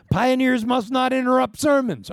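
high, loud mock scold. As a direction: tease her like a stern preacher. Joking rebuke to laughing Gretchen.

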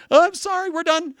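high, loud mock-sorry voice of the people; mock apology. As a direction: ham up a fed-up people begging off. Israelites giving up on idols.

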